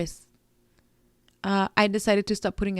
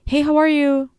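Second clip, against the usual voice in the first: bright, high, loud mock greeting; sing-song friendly hello, a bit hammed-up; cheery check-in; 7 semitones higher, 7 dB louder than usual. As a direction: chirp it like the friend who always reaches out first. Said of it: "I'm tired of reaching out to people".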